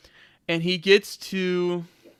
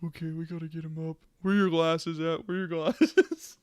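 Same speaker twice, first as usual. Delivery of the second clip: sobered up; 2 semitones lower than usual. Retold or imitated. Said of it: "snaps out of drunk mode".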